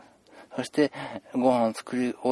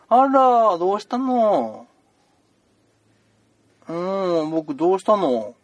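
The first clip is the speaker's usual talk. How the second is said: put-on higher, slow and gentle to a small boy; soothing a child; 4 semitones higher, 7 dB louder, slower than usual. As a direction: coax a scared kid.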